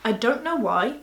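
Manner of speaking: ordinary talk, nothing marked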